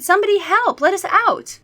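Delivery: high, loud cry for help; shouting for help. Acted. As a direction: yell it out, trapped. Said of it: "I shouted".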